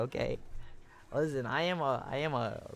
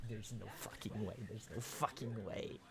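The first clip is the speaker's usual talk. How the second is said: soft, low muttered disbelief; stunned disbelief; 6 semitones lower, 15 dB quieter than usual. Real, not acted. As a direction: mumble it, floored. Disbelief at chat.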